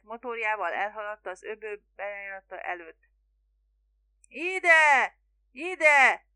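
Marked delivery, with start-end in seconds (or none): 4.3-6.3 high, loud call to a distant boat; roaring shout. A narrator's reading. Spiro calling the little boat, roaring. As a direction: holler it out across the bay. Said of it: "ordított Spiro görögül".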